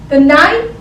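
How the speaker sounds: usual speaking voice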